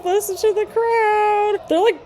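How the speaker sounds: excited. Real, not acted. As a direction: savour the crowd's roar, drawn out. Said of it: "repeats phrase, rising excitement".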